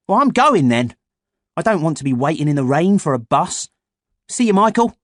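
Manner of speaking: put-on high, loud boy voice; casual goodbye as a young boy; boy character voice. A narrator's reading. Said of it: "Freddie shrugged".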